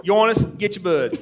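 a hint of Scotty's Maine twang, laughing; lightly voiced Maine guide through a laugh; mock Maine accent. Ending the hunt story, lightly imitating Scotty's Maine accent. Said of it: "without doing his voice".

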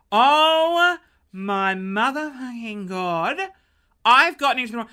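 loud, slowed horrified outburst; loud shocked realisation. Retold or imitated. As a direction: stretch the curse in dismay. Realising wrong car.

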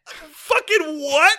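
loud, high, drawn-out incredulous outburst; real disbelief. Real, not acted. Friend learns the family gets killed, shocked disbelief. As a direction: blurt it out, slow and stunned. Shocked at movie massacre news.

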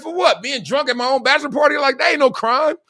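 high-pitched comic objection; acted indignant protest. Drunk bachelor objecting to his friends, mock indignant. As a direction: protest your innocence, voice pitched right up.

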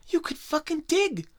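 hammed-up outraged boss scolding quitters; whiny boss god. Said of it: "I was like".

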